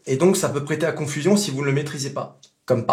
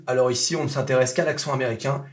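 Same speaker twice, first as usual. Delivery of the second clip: ordinary talk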